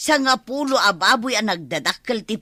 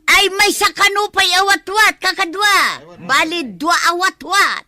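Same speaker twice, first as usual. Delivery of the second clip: calling out; 7 semitones higher, 6 dB louder than usual. Acted. Calling out for more food.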